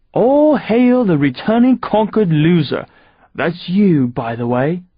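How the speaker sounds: high gloating English villain; fancy English accent. Acted. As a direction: rub it in with English swagger. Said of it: "with his fancy English accent".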